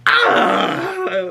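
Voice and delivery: sudden high mock yelp; a quick shrill mock scream of surprise; imitated yell. Imitating May yelping when Pip squirts her eye, high mock yelp. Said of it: "the yell that Amanda Stenberg yells out".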